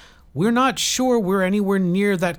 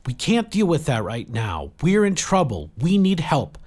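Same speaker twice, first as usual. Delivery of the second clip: high, insistent, raised voice; urgent plea; 8 semitones higher, 5 dB louder than usual. A narrator's reading. Jill pleading with Saradwan for help, urgent. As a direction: push past the objection and plead for help.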